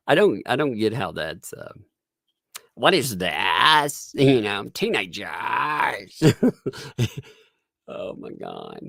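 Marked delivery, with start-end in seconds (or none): 2.7-3.9 slow, stretched-out imitation of teen affectation; mocking affected drawl. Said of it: "What is that sound, man?". 4.7-6.2 higher, very drawn-out teen imitation; mocking teenager affectation. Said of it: "affectation that annoying teenagers".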